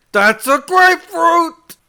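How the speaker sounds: hammed-up heckler's scream, very high and loud; screamed catchphrase. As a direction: pop in and yell it at a whole cinema. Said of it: "he'd pop in and just scream".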